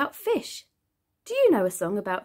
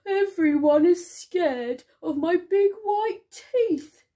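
high, hammed-up wail of a storybook shark; 7 semitones higher, 4 dB louder than usual. A narrator's reading. Smiley Shark laments that everyone fears him, wailing. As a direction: wail it out like a woeful cartoon character. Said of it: "wailed Smiley Shark".

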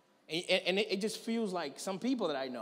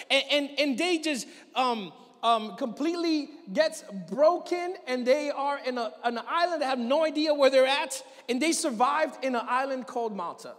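louder, higher preaching at full force; fired-up preaching; 4 semitones higher, 4 dB louder than usual. Acted. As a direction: drive the story home to the congregation. Preaching the voyage story.

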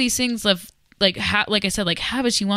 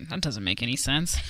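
low, soft, unfazed reply; deadpan dismissal; 7 semitones lower, 7 dB quieter than usual. Retold or imitated. Retelling reply to breakup threat, quietly dismissive. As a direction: brush it off quietly, lower.

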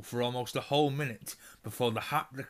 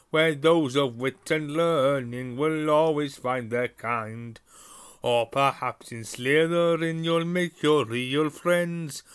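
Sorting Hat's song; 3 semitones higher, 5 dB louder than usual. A narrator's reading. Sorting Hat recites its song to the new students, booming and proud. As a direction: boom out the rhyme like a proud old talking hat. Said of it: "as the hat finished its song".

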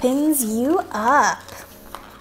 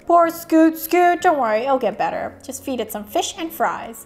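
ordinary delivery

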